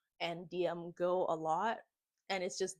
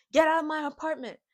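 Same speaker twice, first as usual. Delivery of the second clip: angry order; 8 semitones higher, 9 dB louder, faster than usual. Retold or imitated. She gets mad and says.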